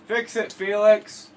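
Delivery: slow, loud, high cartoon catchphrase; Fix-It Felix quote. Gamer quotes Wreck-It Ralph while patching a wall. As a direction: belt it out like the movie hero. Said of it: "Movie Wreck-It Ralph".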